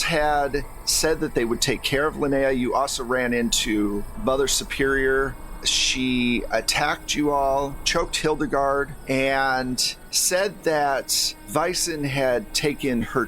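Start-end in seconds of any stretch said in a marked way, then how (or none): none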